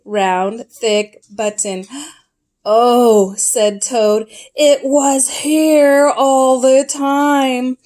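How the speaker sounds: gentle read-aloud storyteller voice; storybook reading. A narrator's reading. Parent reading Frog and Toad to a child, storytelling. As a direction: read it like a bedtime picture book.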